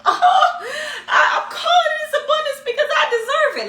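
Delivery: high, loud, hammed-up emotional wail; mock crying. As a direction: act out wailing your intention through overdone tears. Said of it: "So now I'm crying like".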